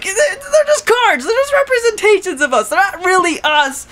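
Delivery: high-pitched defensive cartoon whine; flustered mock protest. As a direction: imitate someone embarrassed and denying it. Voices flustered Baku denying romance.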